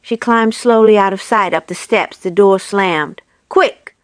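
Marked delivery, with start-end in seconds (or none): 3.5-4.0 urgent. A narrator's reading. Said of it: "said Mister Fox".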